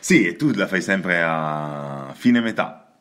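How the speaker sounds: ordinary delivery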